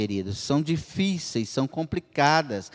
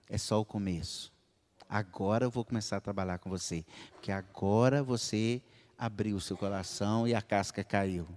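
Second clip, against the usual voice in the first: softer, lower and calm; quiet voice of God; 7 semitones lower, 8 dB quieter than usual. Acted. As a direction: speak as a tender God. God speaking gently. (Deus falando baixo e sereno ao fiel)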